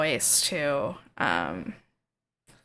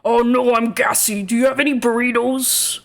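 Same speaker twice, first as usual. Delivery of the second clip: Gruff; 6 semitones higher, 6 dB louder than usual. Shown as a demonstration. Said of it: "that's Gruff's voice".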